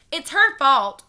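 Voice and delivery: loud, high accusing shout. A narrator's reading. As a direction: shout it, pointing. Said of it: "we shouted together".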